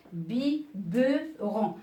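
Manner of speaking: "Biberon" drawn out slowly, syllable by syllable; slow dictation. Shown as a demonstration. Teacher dictates "Biberon" slowly to the class. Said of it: "teacher dictates word".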